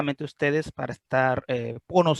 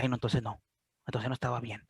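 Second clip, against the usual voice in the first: quiet admission; 4 semitones lower, 8 dB quieter than usual. Acted. Admitting not feeling well.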